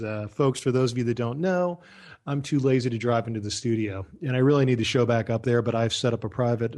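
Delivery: ordinary talk, nothing marked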